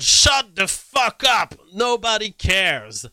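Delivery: a hammed-up yell at critics; mock shout. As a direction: bellow it like an angry poster. Reading a tweet, acting it out.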